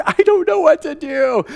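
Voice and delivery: high, panicky helpless thought; mock panic in a store; panicked inner thought. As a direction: blurt it like a cornered dad. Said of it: "I'm like".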